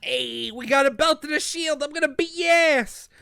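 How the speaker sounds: high, loud hammed-up bravado; mock battle boast. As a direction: crow it like a cartoon hero itching to fight. Acts out character's boast over finds.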